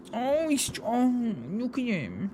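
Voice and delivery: hammed-up gushing over a little pet; annoying pet voice. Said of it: "into annoying pet".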